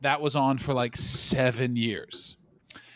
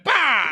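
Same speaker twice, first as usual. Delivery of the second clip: sudden loud, high "bah!"; mimicked crash landing; 13 semitones higher, 8 dB louder than usual. Retelling a botched plane landing, explosive crash sound. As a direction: burst out a mock impact noise.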